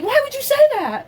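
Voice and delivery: very high-pitched mock disbelief; squeaky-high retold challenge; mock incredulous question. Acts out doctor's startled challenge.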